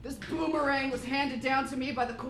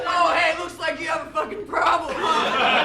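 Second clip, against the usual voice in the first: swaggering hero; 3 semitones higher, 7 dB louder than usual. Acted. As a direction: swoop in as the brash star. Hank swoops in to rescue.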